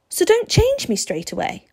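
put-on high, loud boy's voice; boy Steve, pleading. A narrator's reading. Boy bargaining to become a vampire, pleading.

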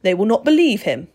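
loud scornful retort; snorting dismissal. A narrator's reading. Vampire brushing off the boy's threat, snorting dismissal. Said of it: "Mister Crepsley snorted".

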